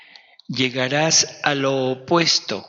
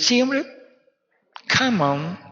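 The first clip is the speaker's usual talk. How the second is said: casual beckoning; 10 semitones higher than usual. Acted. Jesús beckoning Pedro.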